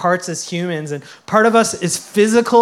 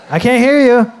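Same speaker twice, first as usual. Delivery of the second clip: louder and higher, egging the crowd on; playful call for a louder response; 5 semitones higher, 6 dB louder than usual. Real, not acted. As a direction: call out to the congregation for a bigger answer. Pastor calling for crowd response.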